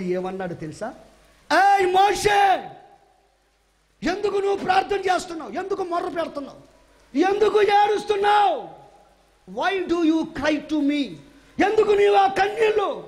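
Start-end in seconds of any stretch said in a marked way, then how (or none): 1.5-13.0 booming, higher divine rebuke; preacher voicing God shouting at Moses; God calling out to Moses. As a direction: thunder down at him from heaven. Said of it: "అని దేవుడు అంటున్నాడండి".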